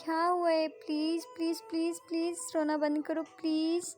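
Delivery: put-on girl calming her weeping friend, slow and pleading; soothing friend. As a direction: gently hush a crying friend. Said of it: "Radhika होती है, बोलती है".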